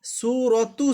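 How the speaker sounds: slow "সুরতু", ending in a voiced tu; full-ending recitation of "সুরতু"; unpaused ending. Shown as a demonstration. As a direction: draw out the first oo, finish "সুরতু" on tu. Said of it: "এটা যদি আমরা অক্ফ করতাম".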